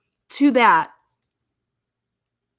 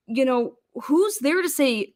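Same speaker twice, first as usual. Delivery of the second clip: ordinary talk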